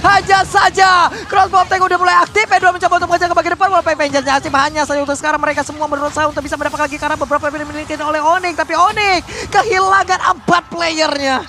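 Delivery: high, loud, rapid play-by-play; fighting game announcer. Real, not acted. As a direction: call the teamfight at full speed. Caster calls a teamfight live. (komentator game yang bersemangat)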